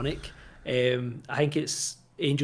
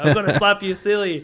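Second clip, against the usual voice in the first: loud, higher mock threat; hammed-up scolding; 5 semitones higher, 8 dB louder than usual. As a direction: imitate an angry man threatening a kid. Acted threat from film scene.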